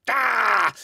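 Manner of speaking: loud, high cry of "ah!"; mock scream of exasperation; frustrated yell. Host reacting to his own missed rule, mock frustrated yell.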